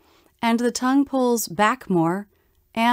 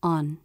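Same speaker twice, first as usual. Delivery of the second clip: "on" short, low and flat; unstressed ON; 5 semitones lower, 4 dB quieter than usual. Shown as a demonstration. Teacher showing a function word, "on" unstressed, brief and low. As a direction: let "on" drop low without stress. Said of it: "Function words are unstressed".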